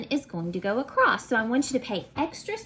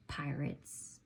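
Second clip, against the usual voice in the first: low whispered word; 8 semitones lower, 15 dB quieter than usual. Acted. Teacher whispering pirates to the class. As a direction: breathe it like a secret. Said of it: "whispered theme word".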